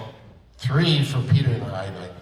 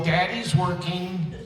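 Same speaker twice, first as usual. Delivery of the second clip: slow, higher teasing dad voice; mock dad; 7 semitones higher, 3 dB quieter, slower than usual. Voice actor jokes about playing dads, slow and teasing. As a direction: playfully tease as a busy dad. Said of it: "I know".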